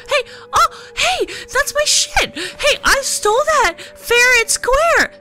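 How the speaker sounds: high, slow whiny protest; indignant thief. Acted. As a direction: squeal it like a wronged thief. Voicing the thief character.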